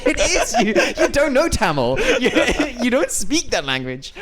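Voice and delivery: high laughing teasing, words through giggles; laughing while talking. Real, not acted. Teasing his friend about language, laughing hard. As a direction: laugh through it as you mock him.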